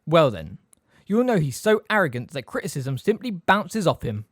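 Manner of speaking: loud, sleekly superior jibe. A narrator's reading. Snape insults Harry's father to Sirius, sleekly. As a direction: slip the insult in with satisfaction. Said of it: "Snape said sleekly".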